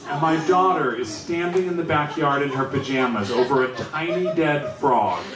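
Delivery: ordinary delivery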